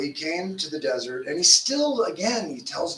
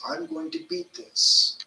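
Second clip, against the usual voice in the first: high, slow vow to beat it; determined self-talk; 13 semitones higher, slower than usual. Shown as a demonstration. Preacher voicing the believer's vow, slow and high. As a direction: lift it like a rallying promise to yourself. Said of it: "We think about our strengths".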